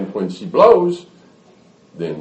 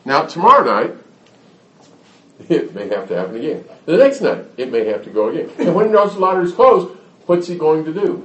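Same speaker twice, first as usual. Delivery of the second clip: ordinary delivery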